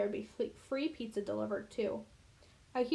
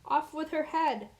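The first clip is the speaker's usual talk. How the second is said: raised, higher, drawn-out command; mild yell; 5 semitones higher, 4 dB louder than usual. A narrator's reading. Queen in Alice in Wonderland ordering a beheading, mild yell. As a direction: call it out slowly like an imperious queen. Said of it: "always yelling".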